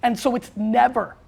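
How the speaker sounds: ordinary talk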